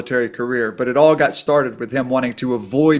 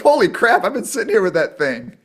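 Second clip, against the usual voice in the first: higher and louder, stunned disbelief; amazed; 7 semitones higher, 4 dB louder than usual. Retold or imitated. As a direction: gasp it out like you just realised the sword was real. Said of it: "I'm like".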